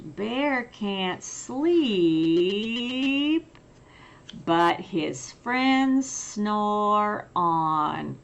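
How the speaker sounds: ordinary delivery, nothing marked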